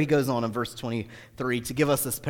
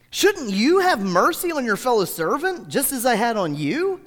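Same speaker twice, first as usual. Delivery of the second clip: angry master; 11 semitones higher, 6 dB louder than usual. Acted. Master rebuking the wicked servant, high and loud anger. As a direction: scold him like a betrayed king. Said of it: "In anger".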